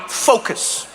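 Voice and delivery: terse "focus", hissed ending, repeated with pauses; mock-intense concentration; serious Dutch cyclist. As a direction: drill the word like a stern rider. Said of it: "in such a serious way".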